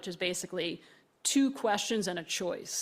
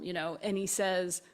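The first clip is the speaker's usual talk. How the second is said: ordinary talk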